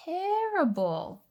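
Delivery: high, slow wail of dismay. Real, not acted. Player groaning at a bad damage roll, drawn-out and high. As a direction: stretch out the word in mock despair.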